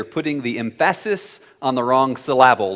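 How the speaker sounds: "emphasis" as em-FASS-is, "syllable" as suh-LAB-ul; teacher's joke misstressing of "emphasis" and "syllable", em-FASS-is, suh-LAB-ul; wrong-syllable stress. Retold or imitated. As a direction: punch the second syllable of "emphasis" and "syllable". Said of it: "mispronounce a word".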